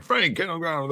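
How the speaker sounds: high-pitched mock police order; mock police command. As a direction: act out officers ordering a suspect down. Retold police arrest command.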